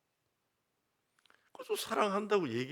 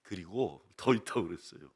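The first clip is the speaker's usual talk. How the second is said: ordinary talk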